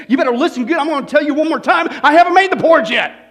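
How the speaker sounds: loud, high nagging rant, building up; exasperated Mama Bear. Acted. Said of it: "She says".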